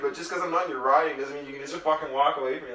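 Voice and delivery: imitating his own fuming at the guy who walked off; mock furious rant. Ranting about the man who walked away, mock furious. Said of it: "And I was like".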